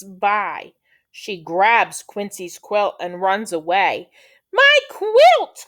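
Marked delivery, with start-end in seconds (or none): 4.5-5.7 high, loud outcry; crying out. Acted. Quail whose quilt is grabbed, crying out. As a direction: yell in mock alarm. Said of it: "cries Quincy".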